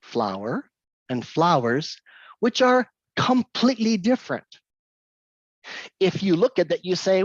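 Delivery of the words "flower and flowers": "flower" then "flowers", the final s clearly added, slowly; contrasting flower and flowers. Shown as a demonstration. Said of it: "two words in English".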